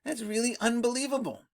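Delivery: ordinary talk, nothing marked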